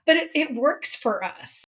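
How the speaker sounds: ordinary talk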